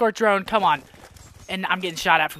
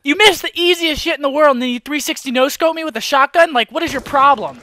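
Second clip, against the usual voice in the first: loud, higher indignant tirade; angry rant; 8 semitones higher, 7 dB louder than usual. Real, not acted. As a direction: berate the other player at raised volume. Rages at player who killed him.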